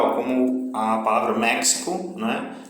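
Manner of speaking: ordinary talk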